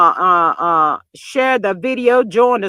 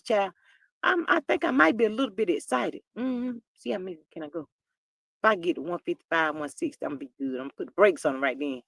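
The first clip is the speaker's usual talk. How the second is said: quiet aside; 2 semitones lower, 7 dB quieter than usual. Real, not acted. Vlogger softly joking to viewers about her hips. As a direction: murmur it to the chat.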